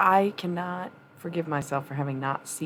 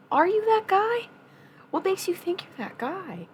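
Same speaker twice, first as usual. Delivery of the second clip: much higher, louder mock challenge; teasing challenge; 3 dB louder than usual. Streamer needling a viewer, high mocking challenge. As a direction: pitch it way up to needle him.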